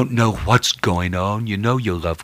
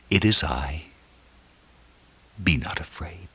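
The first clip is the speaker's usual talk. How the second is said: loving reassurance. Acted. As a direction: comfort frightened men gently. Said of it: "lovingly, reassuredly".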